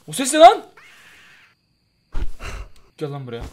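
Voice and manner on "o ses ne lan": startled shout. Real, not acted. Gamer startled by strange sound.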